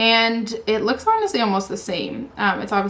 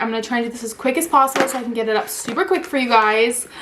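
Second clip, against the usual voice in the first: ordinary talk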